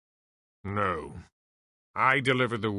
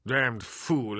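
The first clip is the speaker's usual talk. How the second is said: ordinary talk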